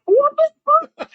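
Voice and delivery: loud, very high incredulous yelp; mock outrage. Storyteller reacting to friend throwing him under the bus, indignant. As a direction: squeal it in hammed-up indignation. Retelling shock at friend's joke.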